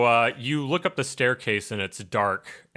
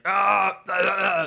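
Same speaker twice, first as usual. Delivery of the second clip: choking gasps; 9 semitones higher, 4 dB louder than usual. Acted. GM voices choking NPC.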